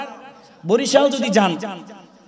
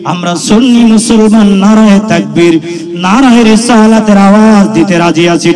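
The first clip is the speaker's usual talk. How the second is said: loud rousing preacher; loud fiery rallying cry; preaching at full force; 5 dB louder than usual. Acted. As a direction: fire the crowd up. Rousing crowd.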